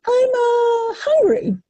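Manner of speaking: high, strained bird voice calling out; hungry bluebird. A narrator's reading. Bluebird tells the worm he is hungry, high squeaky bird voice.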